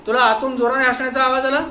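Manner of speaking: loud, high interrogator's voice; stern questioning. Acted. Inspector grilling the watchman, loud and stern. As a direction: press him hard like a cop.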